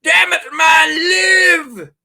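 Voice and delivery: hammed-up frantic cry to save the boy; desperate shout. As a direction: bellow it at the choking king, stretched out. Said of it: "He's like".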